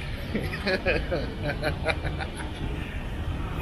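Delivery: breathy happy giggle; soft laugh. Real, not acted. Vlogger to viewers, laughing with happiness. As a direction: laugh from pure joy.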